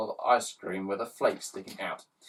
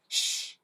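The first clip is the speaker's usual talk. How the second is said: shushing. Acted. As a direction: hush them quickly. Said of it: "shushed Ned".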